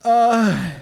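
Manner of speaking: high, loud fake laugh; hammy high laugh. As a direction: laugh at your own joke. Character laugh.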